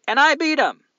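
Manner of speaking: high, loud gloating cry; triumphant boast. Real, not acted. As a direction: crow about your win, loud and high. Boasting about beating chopstick contest.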